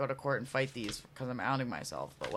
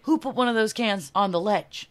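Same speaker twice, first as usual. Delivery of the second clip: raised, louder mock cop voice; imitated cop challenge; 6 semitones higher, 9 dB louder than usual. Cop confronts friends about cans, imitated loud challenge. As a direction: call out like an officer questioning suspects.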